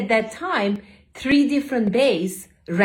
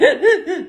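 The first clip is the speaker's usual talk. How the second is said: laugh mixed with a sob; 10 semitones higher, 6 dB louder than usual. Real, not acted. Laugh-cry while describing sobbing.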